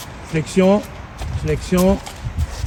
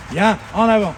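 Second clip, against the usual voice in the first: ordinary talk, nothing marked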